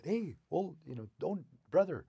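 put-on high coaxing old wolf voice; old man wolf. Old man wolf calming angry wolverine, high coaxing voice. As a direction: soothe him like a sly elder.

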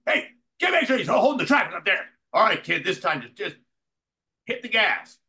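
high, loud, fast shouted orders; shouting director. Retold or imitated. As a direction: yell to the crew up the ramp. Donner shouting to crew.

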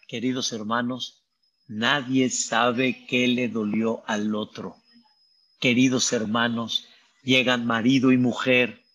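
ordinary delivery

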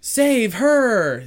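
loud, high mock call to action; imitated rescue cry. Retelling scouts charging after a girl, imitated shout. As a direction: holler it like overeager kids to the rescue.

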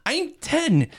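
high, loud mock kid protest; indignant kid. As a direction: squeak it like an offended ten-year-old. Quoting the boy's retort.

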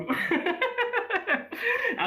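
high-pitched helpless laughter. Real, not acted. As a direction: burst out laughing. Bursting into laughter.

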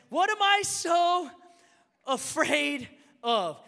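loud, slow, high-pitched self-question; impassioned preaching. Real, not acted. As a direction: stretch the question out at full voice. Preacher cries out the key question.

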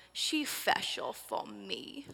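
slow, lightly voiced two-year-old; a hint of a little girl saying "She's special for me"; toddler voice. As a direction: mom lightly imitating her toddler's sweet words. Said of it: "And she'll go".

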